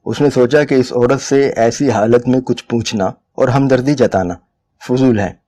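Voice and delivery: usual speaking voice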